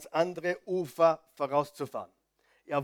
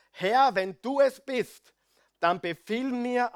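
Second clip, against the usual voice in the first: slow, loud, raised plea to the Lord; Petrus ruft Jesus an; 7 semitones higher, 5 dB louder, slower than usual. Acted. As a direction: call out high and deliberately, word by word. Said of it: "Da sagte Petrus". (langsamer, lauter Anruf an den Herrn)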